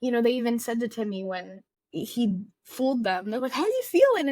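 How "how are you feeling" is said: mock producer. Producers' question, retold mockingly.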